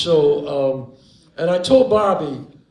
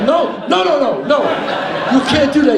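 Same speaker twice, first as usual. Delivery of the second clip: panicked refusal; 9 semitones higher, 7 dB louder than usual. Retold or imitated. Panicked refusal to the wise guy.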